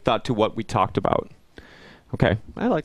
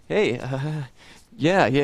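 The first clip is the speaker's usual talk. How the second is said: mildly uneasy, higher-pitched hello; awkward greeting imitation; 4 semitones higher, much slower than usual. Acquaintance meeting the evangelist days later, imitated awkward greeting. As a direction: act like you owe them money and got spotted. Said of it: "it's really very awkward".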